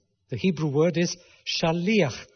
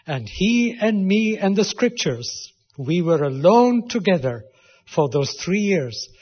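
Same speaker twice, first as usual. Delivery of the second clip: loud, lifted preaching voice; preaching at full force; 2 semitones higher, 5 dB louder than usual. Acted. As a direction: drive Paul's words home to the hearers. Preaches Paul's words forcefully.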